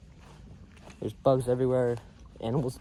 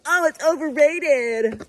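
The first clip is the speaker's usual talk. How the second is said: mocking the haters; 10 semitones higher, much slower than usual. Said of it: "People are like".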